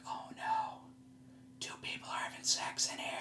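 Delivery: whispered in alarm. Retold or imitated. Waking beside a couple, horrified whisper. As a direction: hush it, horrified, unvoiced. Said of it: "And I'm like".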